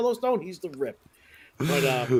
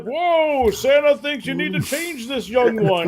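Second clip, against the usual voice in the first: higher, jolly put-on Santa voice; 6 semitones higher, 4 dB louder, slower than usual. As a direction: play a jolly Santa covering his shock. Voicing Santa to the parents.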